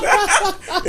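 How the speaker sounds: short high burst of laughter; quick high-pitched cackle; laughing. Real, not acted. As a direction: let out a high, real laugh. Both men burst out laughing.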